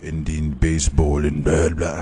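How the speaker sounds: badly faked narrator voice with an accidental accent; half-hearted celebrity narration, breaking down into blahs; James Earl Jones. Fan attempts the short film's narration, bad impression. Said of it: "James Earl Jones impression ever".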